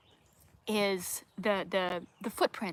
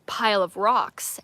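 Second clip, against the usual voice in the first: ordinary talk, nothing marked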